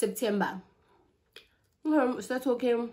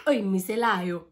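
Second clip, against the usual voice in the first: normal talking voice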